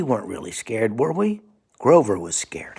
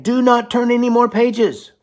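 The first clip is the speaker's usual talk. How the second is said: much higher, louder puppet plea; Grover voice pleading; 13 semitones higher, 6 dB louder than usual. Acted. Grover begging the child, high loud puppet voice. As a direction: cry it out high like a panicking muppet. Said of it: "So Grover is saying".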